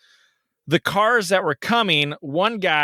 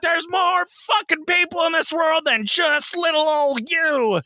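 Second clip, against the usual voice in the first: much higher furious shout; laying into her; 13 semitones higher than usual. Retold or imitated. Driver scolding the girl outside Petco, furious. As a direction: rub it in her face. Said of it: "laid into this girl".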